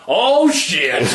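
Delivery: high, loud startled yelp. Pretend. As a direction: jump at the shock. Startled reaction acted out.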